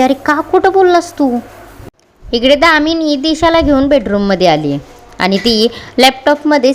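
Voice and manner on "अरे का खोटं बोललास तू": sharp, higher angry question; angry scolding. A narrator's reading. Paru scolds Gani for lying, higher angry voice. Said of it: "Paru त्याच्यावर चिडते आणि म्हणते".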